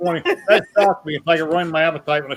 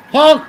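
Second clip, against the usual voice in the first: loud high honking horn noise; 9 semitones higher, 9 dB louder than usual. Pretend. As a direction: honk like a clown horn. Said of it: "You need a horn".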